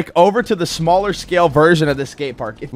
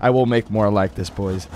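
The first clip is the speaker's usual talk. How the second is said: ordinary delivery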